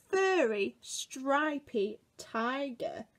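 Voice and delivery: ordinary delivery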